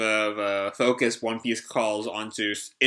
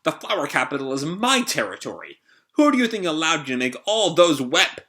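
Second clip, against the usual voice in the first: acted outburst, loud on the opening; raised, indignant character voice; indignant snap; 4 semitones higher, 4 dB louder than usual. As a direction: protest like an affronted overlord. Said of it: "Orochi turns to him and snaps".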